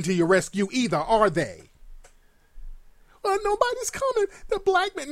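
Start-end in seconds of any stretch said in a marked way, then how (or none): 3.2-5.1 high, mocking imitation of the woman crying that nobody comes. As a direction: squeak it out high, mocking her complaint.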